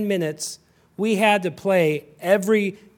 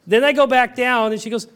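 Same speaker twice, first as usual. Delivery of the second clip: normal talking voice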